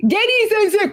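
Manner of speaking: put-on high, childlike call; kids calling out. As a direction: sing it out like a schoolkid.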